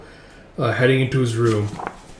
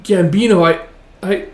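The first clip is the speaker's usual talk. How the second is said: higher, louder faltering reply; lightly acted distress; shaken stammer; 7 semitones higher, 6 dB louder than usual. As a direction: stammer back at an accuser. Stammering at accuser.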